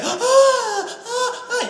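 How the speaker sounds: very high, loud gasping cries; mock climax cries. Mimicking a woman climaxing, very high gasping cries. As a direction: squeal out like drowning. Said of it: "you're just like".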